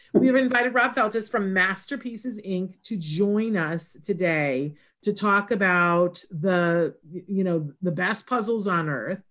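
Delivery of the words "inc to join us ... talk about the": ordinary talk, nothing marked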